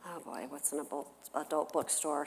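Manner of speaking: dropped to a quiet worried echo; soft inner groan, talking to herself; inner dread. Retold or imitated. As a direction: repeat the question under your breath, dreading it. Mom's inner panicked echo.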